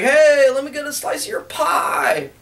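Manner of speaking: high, shouted sleazy come-on; mock sleazy pickup line shouted across the room; sleazy customer voice. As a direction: call out like a leering sailor.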